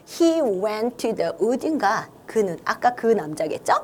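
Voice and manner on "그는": ordinary talk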